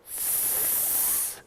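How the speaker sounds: long voiceless "S" hiss; S sound. Shown as a demonstration. Host and puppet practising together, long hissed "S". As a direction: hold "S" with no vowel. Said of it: "Let's say our S".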